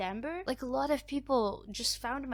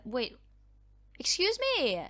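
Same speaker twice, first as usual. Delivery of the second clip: ordinary talk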